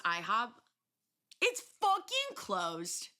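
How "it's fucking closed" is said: high, slow drawn-out wail; slow, high it's closed; outraged disbelief. Real, not acted. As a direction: stretch it out in dismay. Disappointed at closed IHOP.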